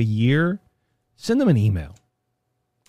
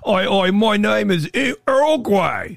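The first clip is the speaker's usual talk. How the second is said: mock Cockney accent; 6 semitones higher, slower than usual. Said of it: "that's the most English, English name".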